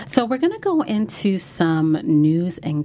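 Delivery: slow hushed whisper, sharing juicy news; news and gossip voice. Acted. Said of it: "Is, is that the news and gossip voice?".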